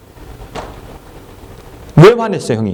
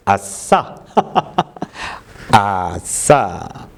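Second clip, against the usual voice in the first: a slow, low, drawn-out gloating yes; hammed-up gloat over the reward; gleeful yes; 9 semitones lower, much slower than usual. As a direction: relish the prize like a greedy boy.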